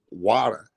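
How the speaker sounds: "water" said American-style, wah-der; mock-American "water"; American pronunciation. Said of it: "It's not water".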